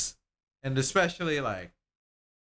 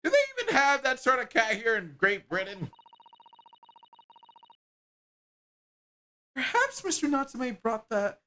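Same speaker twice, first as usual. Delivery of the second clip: higher, animated character voices; two game characters bickering, voiced higher; voiced game dialogue; 10 semitones higher, 4 dB louder than usual.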